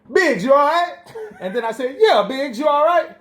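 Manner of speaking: high, teasing check-in; hammed-up caring call; mock concern. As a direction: call it out, faux worried. Mock concern calling to Biggs.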